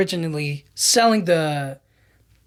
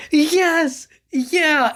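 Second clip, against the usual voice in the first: mock Ned; 9 semitones higher than usual. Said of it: "Ned is like".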